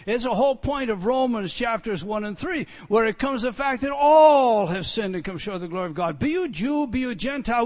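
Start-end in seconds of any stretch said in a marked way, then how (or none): 2.9-7.7 loud proclaiming; preaching shout. Real, not acted. Preacher proclaiming that all have sinned, loud shout. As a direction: shout it out to the hall.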